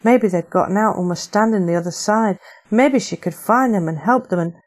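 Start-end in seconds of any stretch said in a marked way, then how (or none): none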